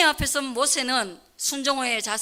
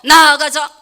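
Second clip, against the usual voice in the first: loud, ringing preacher's cry; full-force preaching; 4 semitones higher, 14 dB louder than usual. Acted. Pastor rallying the congregation, preaching at full force. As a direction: call the flock forward at full voice.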